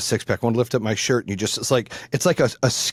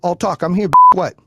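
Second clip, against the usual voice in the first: angry challenge; 5 semitones higher, 9 dB louder than usual. Retold or imitated. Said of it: "I was like".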